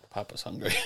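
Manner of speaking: teasing whispered aside; whispering. Acted. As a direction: whisper it as a joke. Teasing aside about eating.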